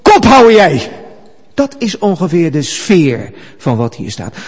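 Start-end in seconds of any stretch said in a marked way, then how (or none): 0.0-0.9 loud, high, coarse command; rude shout. Acted. Angel silencing Satan in coarse words, rude shout. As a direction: bellow it rudely to shut someone up. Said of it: "Als ik het even grof mag zeggen".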